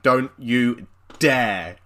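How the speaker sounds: very slow, word by word; mock warning. Friend warning him off the Duel Arena, slow mock warning. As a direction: spell out the warning slowly.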